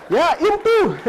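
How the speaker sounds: put-on high, loud insisting Indian-accented voice; mock Indian teacher. Indian teacher insisting to the boy, loud high accent. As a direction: insist loudly with an Indian lilt.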